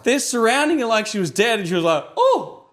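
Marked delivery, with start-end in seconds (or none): 2.1-2.7 very high, loud mock "oh"; exaggerated squeak of surprise; startled oh. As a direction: imitate waking to a shock. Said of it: "like this".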